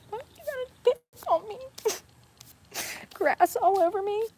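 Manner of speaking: high whiny mock complaint. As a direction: whine it playfully.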